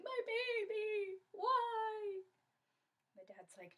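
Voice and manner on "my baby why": high, loud, drawn-out wail; anguished wailing. Acted. Mother over her injured daughter, anguished wail. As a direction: lament dramatically over your child.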